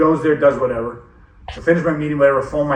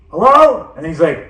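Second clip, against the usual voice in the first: put-on high, loud puzzled old man's "Hello?"; a confused dad answering the phone; 8 semitones higher, 3 dB louder than usual.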